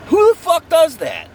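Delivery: sudden high, loud shout of disbelief; disgusted cry at the killer, shouted high; outraged yell. Real, not acted.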